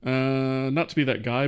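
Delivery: ordinary delivery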